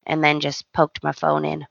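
usual speaking voice

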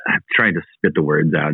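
usual speaking voice

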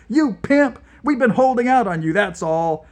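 high, loud insult, fast in the middle; furious insult. Acted. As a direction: spit the insult at him. Nikita insulting the interrogator.

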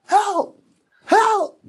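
very high, whimpering little cries of a trapped dog; pleading dog voice. Retold or imitated. Trapped dog in the foundation begging for help, high whimpers. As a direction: squeak it out like a stuck puppy begging. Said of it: "And it was going".

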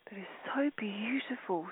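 breathy meditative whisper. Acted. Guide whispering to meditating listeners, hushed. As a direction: let the words dissolve into a whisper.

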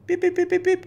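very high-pitched run of pips; whistle blasts. Retold or imitated. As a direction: imitate a whistle calling the dog back. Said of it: "Stops to the whistle".

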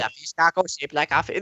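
put-on slipping into a jokey Mexican accent; a failed Hawaiian accent that comes out Mexican; mock Mexican accent. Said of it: "There, I did it again".

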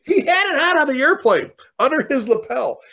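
high, loud, astonished; can't believe it; amazed. Real, not acted. Telling the host about the medal, high and amazed.

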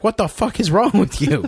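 high, loud horrified cry; shocked outburst. Retold or imitated. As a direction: blurt it out appalled. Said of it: "And he's like".

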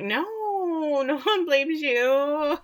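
high, very drawn-out fake-sympathetic wail; mock consoling. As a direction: coo it slowly and high, badly faked. Mocking Jeanette's consoling Kate.

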